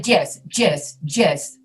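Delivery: "yes" begun with a J, like "jess"; hard J onset on each "yes"; Spanish-speaker J. Shown as a demonstration. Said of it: "sometimes Spanish speakers will say".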